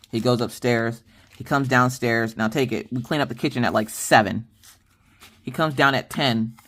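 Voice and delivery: ordinary talk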